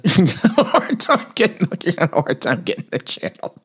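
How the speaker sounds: laughter breaking up the words; laughing through words. Real, not acted. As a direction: try to talk through a chuckle. Joking about reception, laughing through it.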